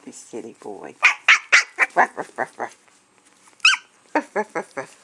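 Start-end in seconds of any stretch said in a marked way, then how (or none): 1.9-5.0 imitated dog bark. Imitating barking back at dog.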